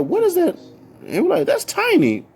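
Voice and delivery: high alarmed squeal; surprised. Retold or imitated. Kids hear screaming down the hall, shocked squeal. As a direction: shriek it in startled disbelief.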